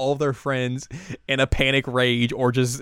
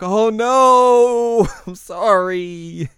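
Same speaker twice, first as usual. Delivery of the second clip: high, loud, slow laughing sorry; a high, slow, giggling apology; sheepish apology; 10 semitones higher, 9 dB louder, much slower than usual. Retold or imitated. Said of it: "Mugi's like".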